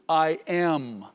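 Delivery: "am" drawn out and weighted, standing alone; stress on "am". Shown as a demonstration. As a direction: lean on the verb in "I am". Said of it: "The emphasis of his statement was on the verb tense".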